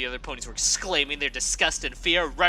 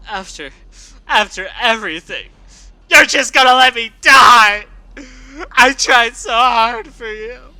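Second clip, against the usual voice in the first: loud, high, sobbing pleas; crying and shouting; 7 semitones higher, 15 dB louder than usual. Acted. Scootaloo begging Rainbow Dash not to let her die, sobbing. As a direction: break down crying as you shout. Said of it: "Her throat hurt from crying and shouting".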